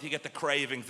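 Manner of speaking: usual speaking voice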